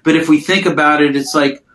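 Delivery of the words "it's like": ordinary talk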